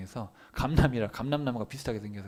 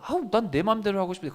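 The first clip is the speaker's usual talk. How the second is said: higher-pitched mock protest; mock complaint; 7 semitones higher, 4 dB louder than usual. A creature resisting its creator, acted complaint. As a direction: grumble in a raised pitch, imitating a wilful person.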